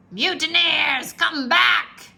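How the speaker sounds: loud, slow angry yell; captain shouts at deserting crew; furious shout. A narrator's reading. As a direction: roar after the fleeing men. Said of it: "shouted Captain Bones".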